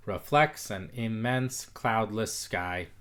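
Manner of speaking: slow reading. Shown as a demonstration. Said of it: "Close, no cigar".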